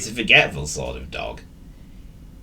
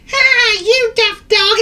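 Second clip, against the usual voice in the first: very high, loud squeaky bird; shrill cartoon bird, mocking; laughing bird; 8 dB louder, slower than usual. A narrator's reading. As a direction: jeer in a piercing bird voice. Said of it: "She laughed".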